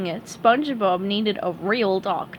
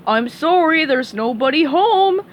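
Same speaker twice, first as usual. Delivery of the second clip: louder, raised voice through the door; calling out; 6 semitones higher, 5 dB louder than usual. Acted. Patrick lying to Sandy through the door, called out. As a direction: call out a fib through the door. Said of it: "called Patrick".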